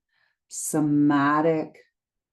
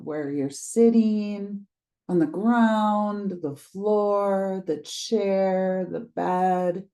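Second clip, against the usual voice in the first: calm guided meditation read, unhurried pauses; 2 semitones higher than usual. Acted. Teacher guiding listeners through a grounding practice, meditation voice. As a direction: lead them gently into the body.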